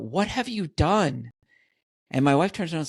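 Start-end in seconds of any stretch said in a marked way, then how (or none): 0.0-1.3 higher, slow, drawn-out mock yell. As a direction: call it out in shocked disbelief at the microwave. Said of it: "I literally yell out".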